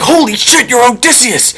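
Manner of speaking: high, startled cry; shocked discovery. Acted. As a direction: gasp it out as she recognizes him. Servant girl recognizes scar.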